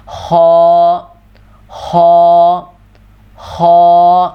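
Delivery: خو with rounded lips, heavy and full; خو rounded and thick from the throat; heavy kho. Shown as a demonstration. Teacher showing the heavy letter خ, lips rounded. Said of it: "موٹا کر کے منہ کو گول کر کے".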